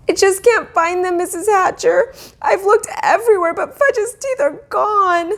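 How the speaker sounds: put-on high, tearful little girl; sniffling girl. A narrator's reading. Sheila sniffles to Mrs Hatcher, high and tearful. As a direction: plead through sniffles. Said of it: "Sheila sniffled".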